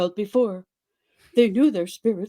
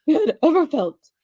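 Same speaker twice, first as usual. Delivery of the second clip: laughing while reading; 6 semitones higher, 6 dB louder than usual. Real, not acted. Reader cracks up at steamy line.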